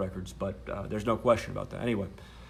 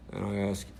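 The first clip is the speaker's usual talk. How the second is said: usual speaking voice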